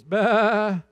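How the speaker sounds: loud bleating goat. Pretend. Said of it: "that would sound a good goat impression".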